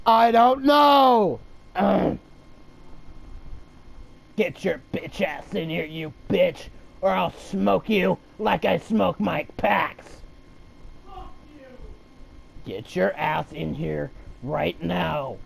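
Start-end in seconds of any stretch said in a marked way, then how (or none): 4.4-15.4 low, soft threatening growl; menacing villain. Acted. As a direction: lure them in like a killer.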